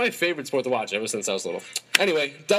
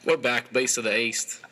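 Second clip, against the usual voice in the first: normal talking voice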